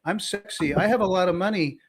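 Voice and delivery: high-pitched put-on come-on; flirty scam persona. Scammer introducing Jennifer to a wrong number, put-on flirtation. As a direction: imitate a scammer posing as an alluring stranger. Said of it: "or they're like".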